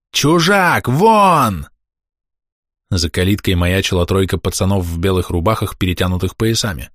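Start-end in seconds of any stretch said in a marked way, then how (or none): 0.1-1.7 high, hostile cry; hateful shout. A narrator's reading. Villager orders the outsider out, hateful shout. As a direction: drive him off with a high yell. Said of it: "полный ненависти окрик".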